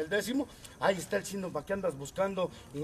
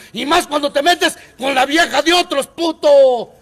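rage; 5 semitones higher, 15 dB louder than usual. Real, not acted. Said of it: "Eso sí es cólera".

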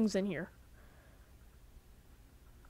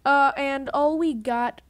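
normal talking voice

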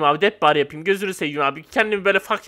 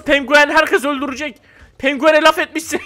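ordinary talk

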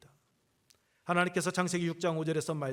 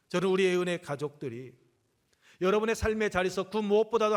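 rising, louder preaching push; fervent preaching; 5 semitones higher, 7 dB louder than usual. Acted. Pastor urges the congregation to put God's word first, fervent preaching. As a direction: drive the exhortation home to the congregation.

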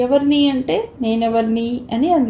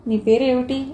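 plain reading voice

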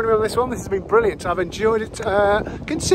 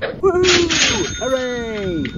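excited cheer; 4 semitones higher, much slower than usual. Real, not acted. Cheering over a find.

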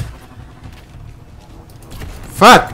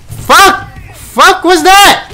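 high loud cursing; angry yell; 12 semitones higher, 4 dB louder, much slower than usual. Real, not acted. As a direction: shout at the screen. Raging at game.

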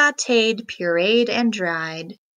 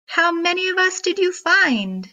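impatient; 8 semitones higher than usual. Acted. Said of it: "Impatiently they asked".

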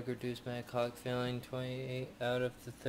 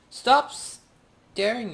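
high, loud, stretched-out yell; shouted demand; 10 semitones higher, 11 dB louder, much slower than usual. Real, not acted. Player to a creepy game character, shouting. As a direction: yell it slowly at whoever is watching.

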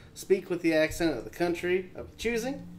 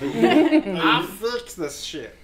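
laughing; 8 dB louder, much slower than usual. Acted. Engineer retort, laughing.

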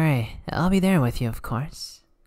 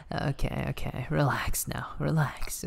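soothing whisper; 6 dB quieter than usual. Acted. Calming the flustered listener.

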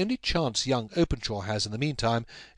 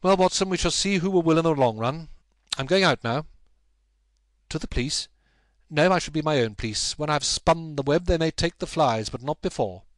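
louder, resolute vow; determined Holmes; 5 semitones higher, 5 dB louder than usual. A narrator's reading. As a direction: set your jaw and promise victory. Holmes vows to catch gang.

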